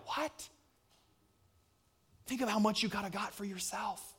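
imitated incredulous aside; hushed disbelief. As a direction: gasp it quietly as a baffled onlooker. Voicing the world's disbelieving reaction.

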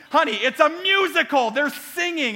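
high, loud exasperated retort; hammed-up wife snapping "Honey, it's a musical". Wife's exasperated retort retold.

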